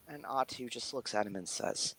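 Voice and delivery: ordinary talk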